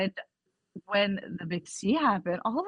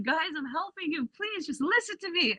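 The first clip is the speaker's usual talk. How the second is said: pleading; 8 semitones higher than usual. Retold or imitated. Begging family members.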